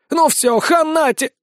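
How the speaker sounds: high-pitched angry threat, cut off; menacing yell. A narrator's reading. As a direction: lunge in with the threat. Mob about to attack, cut off. (яростная угроза, оборванная на полуслове)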